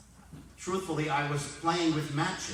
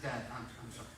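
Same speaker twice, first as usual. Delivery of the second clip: sheepish apology; 3 semitones lower, 12 dB quieter, faster than usual. Retold or imitated. Son caught with calendar apologising.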